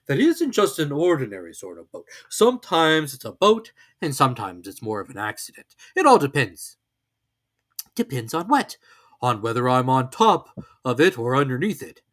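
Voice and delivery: a cosy storybook bear, proud; Pooh voice. A narrator's reading.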